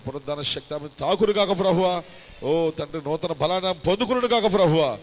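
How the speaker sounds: from steady to loud, high pleading; building healing prayer. Acted. Pastor praying for the sick, rising to a loud cry. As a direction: swell into a fervent plea for healing.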